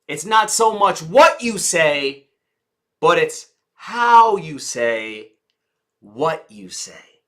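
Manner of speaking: slow, WHAT stressed, then long pauses and a whispered close; emphatic delivery. Shown as a demonstration. Coach sums up the lesson to the audience, slow and emphatic.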